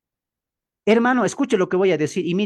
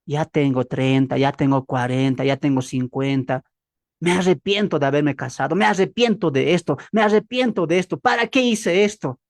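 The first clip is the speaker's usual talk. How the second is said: lower, mopey lament of regret; lightly voiced bitter complainer; 7 semitones lower than usual. A preacher mimics a bitter believer, mildly mopey lament. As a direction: moan it like someone tired of life.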